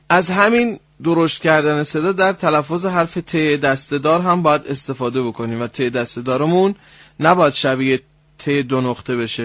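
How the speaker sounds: ordinary talk, nothing marked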